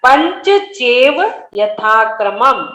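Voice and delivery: पंचचेव यथाक्रमम at half speed, each word separate; slow correct pronunciation. Shown as a demonstration. Teacher showing how to practise slowly, careful पंचचेव यथाक्रमम. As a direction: linger on पंचचेव, then यथाक्रमम. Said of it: "धीरे धीरे एक एक शब्द को शुद्ध उच्चारण".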